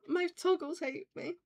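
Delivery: high-pitched mock shock; mock dismay. Streamer repeating the complaint about toggles, mock dismay. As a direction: pitch it up, playfully aghast.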